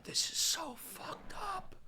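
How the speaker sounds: slow, breathless whisper of disgust; horrified whisper. Real, not acted. Host reacting to the exhausted medic, whispered in horror. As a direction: let it slip out under your breath, appalled.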